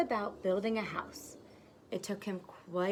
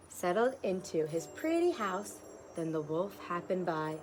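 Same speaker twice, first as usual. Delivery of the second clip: ordinary delivery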